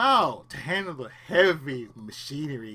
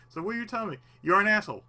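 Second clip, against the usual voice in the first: usual speaking voice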